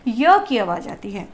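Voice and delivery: usual speaking voice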